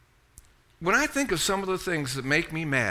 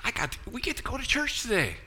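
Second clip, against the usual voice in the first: eager churchgoer; 9 semitones higher than usual. Retold or imitated. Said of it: "Did you get up this morning and go".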